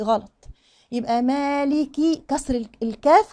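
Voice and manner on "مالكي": slow "مالكي" with a clear i on the kaf; full kasra. Shown as a demonstration. Teacher models the kaf's kasra, clearly pronounced. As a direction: lean into the final i of "مالكي". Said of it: "كسر كويس".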